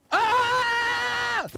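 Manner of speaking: high excited yelp; joyful scream. Retold or imitated. [name] runs between the trailers with a high joyful shriek. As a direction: shriek with delight at seeing him.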